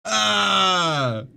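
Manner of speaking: loud, high, drawn-out "Ah!"; exasperated yell. Real, not acted. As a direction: cry out as the bit collapses. Breaks off the joke in exasperation.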